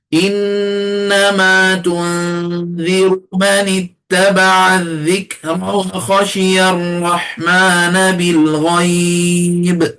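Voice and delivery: slow, drawn-out tajwid recitation; Quran recitation. Shown as a demonstration. Teacher reciting the verse slowly for students to copy. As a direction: chant each word slowly, as in tartil.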